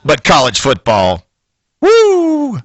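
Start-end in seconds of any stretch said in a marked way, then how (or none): 1.8-2.6 high, exuberant fan whoop; a high fan's "woo"; excited whoop. Acted. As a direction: let out a big college football whoop. Cheering on college football.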